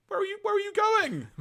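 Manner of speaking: high, desperate pleading call; panicked high whine. Retold or imitated. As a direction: plead like an abandoned kid. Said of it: "basically going".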